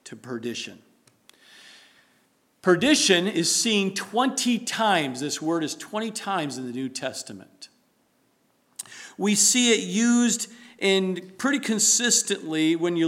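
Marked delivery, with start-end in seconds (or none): none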